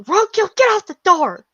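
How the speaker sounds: high-pitched call to the dog; acted yell. Shop owner orders her dog off the door, high acted yell. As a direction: yell it high, as if from another room. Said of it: "You'll finally hear [name] yell".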